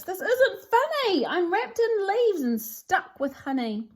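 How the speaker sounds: high, loud indignant wizard; outraged trapped lizard; angry shout. Acted. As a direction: yell it out, furious at being stuck.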